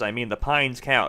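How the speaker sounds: Pines pulled out slow and heavy, the rest of the line calm; stress on Pines. Acted. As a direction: lean hard on the word Pines. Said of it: "he stresses".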